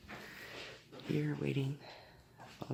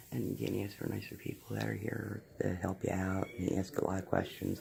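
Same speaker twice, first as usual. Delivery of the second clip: whispering; 5 semitones lower, 8 dB quieter than usual. Real, not acted. Whispering in dental office.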